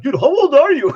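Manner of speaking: high, loud mocking disbelief; laughing mock disbelief; incredulous dude. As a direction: ham up a stunned neighbour asking his age, laughing. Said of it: "And people are like".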